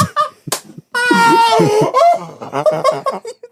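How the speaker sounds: high, helpless cackle; howling laughter. Real, not acted. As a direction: lose it completely. Punchline hysterics.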